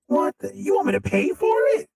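high fast indignant shout; mock outrage. Asked to pay for cake, high mock outraged shout. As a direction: yell in comic disbelief.

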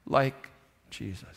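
hushed, intimate landing; soft emphasis. Real, not acted. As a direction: drop low and soft on Jesus.

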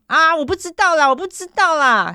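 high, loud hammed-up exasperation; mock flustered protest. Suspect to detective under questioning, mock flustered protest. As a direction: imitate a cornered suspect blurting it out.

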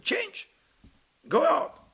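high-pitched, outraged retort; acted high, indignant outburst; scornful rejection. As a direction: throw it back at them in a high voice. Acted leader scornfully refusing visitor.

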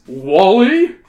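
can't believe it's him; disbelief. Acted. Hero recognising Wally's voice, in disbelief. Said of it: "you cry in disbelief".

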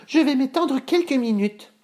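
put-on very high, sleepy girl voice; high voice of a girl ready for bed; yawning little girl. A narrator's reading. Boucle d'Or wanting to lie down, sleepy high voice. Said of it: "en bâillant".

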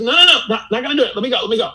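high, loud, flustered mock pleading; retold panicked refusal. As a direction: act out someone desperate to get free. Acting out sister's panicked refusal to cry.

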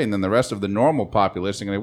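usual speaking voice

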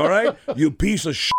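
[name]. Retold or imitated. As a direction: curse him out in mock anger. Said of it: "kinda cursing him out".

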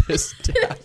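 very high laughing squeal on "This dab"; squeaky mock echo. Mocking the dab.

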